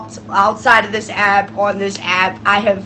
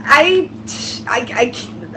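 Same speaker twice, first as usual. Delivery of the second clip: normal talking voice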